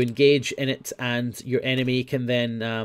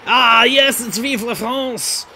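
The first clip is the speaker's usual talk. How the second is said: put-on French accent; 10 semitones higher, 7 dB louder, much slower than usual. French hat unboxing.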